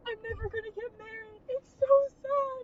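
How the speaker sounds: hammed-up tearful lament, stretching the last words; mock crying. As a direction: imitate someone bawling over their love life. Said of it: "starts crying, and he's like".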